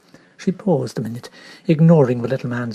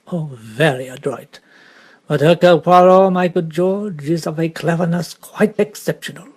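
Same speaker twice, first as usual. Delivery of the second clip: lightly French detective, savouring his own cleverness; a hint of smug Belgian detective; Poirot, pleased with himself; 3 dB louder than usual. Poirot boasting to his valet George, lightly accented.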